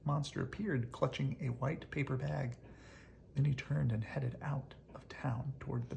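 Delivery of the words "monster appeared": usual speaking voice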